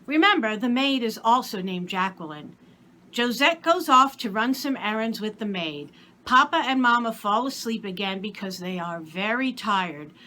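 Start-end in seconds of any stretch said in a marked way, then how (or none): none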